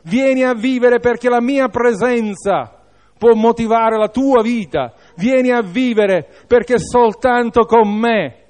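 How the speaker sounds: high, impassioned appeal; fervent preacher's plea in the voice of Christ; loving invitation. Acted. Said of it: "è un invito di amore".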